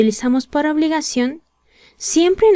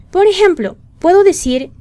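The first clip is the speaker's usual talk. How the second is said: lively example intro; 8 semitones higher, 5 dB louder than usual. Real, not acted.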